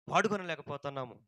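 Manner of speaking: ordinary delivery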